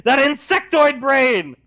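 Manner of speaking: high, loud threatening shout; furious threat. Acted. Lorelai threatening Scar across the room, furious shout. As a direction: threaten him at full volume.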